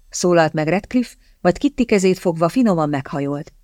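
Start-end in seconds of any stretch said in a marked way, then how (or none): none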